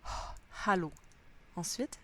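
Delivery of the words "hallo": hallo opened with a misty breathed H; breathy H in hallo. Shown as a demonstration. Teacher demonstrating the H of hallo. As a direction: fog up a glass on the H of hallo. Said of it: "de la buée sur un verre".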